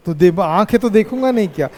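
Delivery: ordinary delivery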